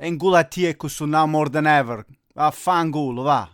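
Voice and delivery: loud, heated Italian outburst; angry rant. Acted. Fed-up dad snaps at his friend, loud angry Italian curse. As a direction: blow up and curse it out.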